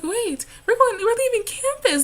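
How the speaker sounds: high, loud, thrilled outburst; excited realisation. Retold or imitated. College student reliving first trip off campus, excited realisation. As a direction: blurt it out as a sudden discovery.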